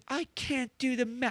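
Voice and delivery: high, strained stab at a whiny princess; failed princess impression. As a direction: try a whiny posh princess and break off. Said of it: "I can't do the voice".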